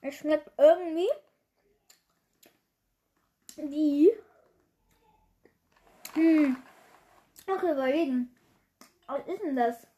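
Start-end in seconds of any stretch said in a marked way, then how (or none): none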